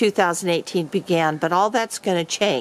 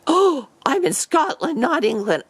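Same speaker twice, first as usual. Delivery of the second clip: high, sheepish oh of realization; sudden realization; 6 semitones higher than usual. Retold or imitated. Brother realizing he is in Scotland, high and sheepish. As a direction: catch yourself mid-blunder, pitch jumping up.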